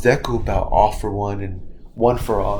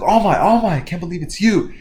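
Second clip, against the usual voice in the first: high, loud fanboy squeal; geeking out; 8 semitones higher, 5 dB louder than usual. Retold or imitated. Fanboy Deku meets his idol All Might, high excited gush. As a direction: gush at your idol like a starstruck fan. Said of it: "he started geeking out".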